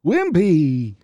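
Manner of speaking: high-pitched, drawn-out cartoon voice; Wimpy. Shown as a demonstration. Showing the co-host how he talked, exaggerated Wimpy voice. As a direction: stretch each word in a hammed-up cartoon impression. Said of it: "He talked like this".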